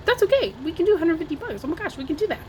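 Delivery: high, gushing reassurance; excited relief. Retold or imitated. Woman telling her helper the cost is fine, excited relief. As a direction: rush it out high, thrilled it is doable. Retold relieved answer to good help.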